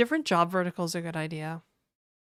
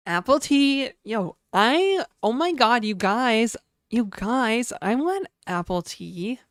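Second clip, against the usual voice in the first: higher, louder gushing cutesy voice; e-girl voice; 4 semitones higher, 3 dB louder than usual. Pretend. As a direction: squeal like a bubbly e-girl. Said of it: "the e-girl voice".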